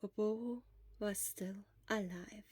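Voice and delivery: slow whispered last line; whispered ending. A narrator's reading. Narrator closing the sad tale, whispered. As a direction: let it hang in a hush.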